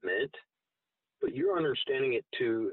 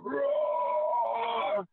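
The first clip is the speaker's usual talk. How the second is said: retching roar; 12 semitones higher than usual. Retold or imitated. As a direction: roar it up from the gut like a dragon. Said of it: "retching the loudest roar ever".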